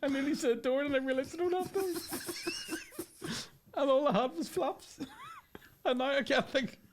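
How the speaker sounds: high, squeezed voice through laughter; helpless laughter. Real, not acted. Comedian confessing his slip about doors and flaps, helplessly laughing. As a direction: struggle to talk while cracking up.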